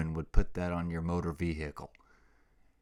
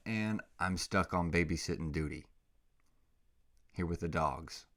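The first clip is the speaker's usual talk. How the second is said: ordinary talk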